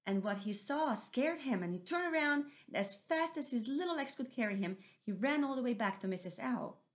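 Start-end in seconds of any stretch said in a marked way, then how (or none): none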